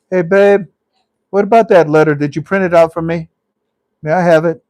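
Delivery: loud, high call to a partner. Real, not acted. Host asking partner for a printed letter, calling out. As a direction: raise your voice to someone off mic.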